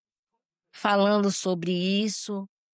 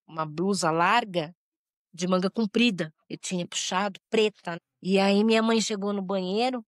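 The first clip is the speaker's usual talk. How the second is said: ordinary delivery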